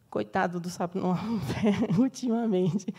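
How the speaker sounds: breaking into a laugh mid-phrase. Real, not acted. Teacher joking about the poor toad, laughing. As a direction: joke it lightly, laughter running through the words.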